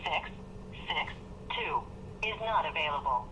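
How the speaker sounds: usual speaking voice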